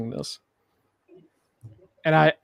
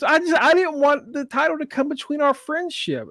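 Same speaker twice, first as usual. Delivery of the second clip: much higher, innocent mock voice; mock sweet Tatum; 9 semitones higher than usual. Tatum excusing herself to Izzy, mock innocent. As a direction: play it falsely sweet. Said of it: "And Tatum says".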